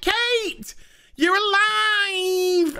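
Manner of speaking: high, drawn-out cry of joy; overjoyed cry. Retold or imitated. Luke and Mary finding Kate alive, high and joyful. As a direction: gasp it with delighted relief. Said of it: "they're like".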